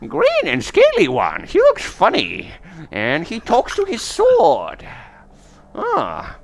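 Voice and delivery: high-pitched crone, drawn out at the end; old seer woman. Acted. Old seer describes the one in the bones, high crone voice. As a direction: play the bone-reading old woman up high. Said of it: "and she's like".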